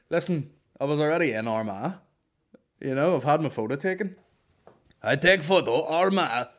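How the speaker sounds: mildly mock Eastern European explaining; Eastern European accent. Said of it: "he's basically going like".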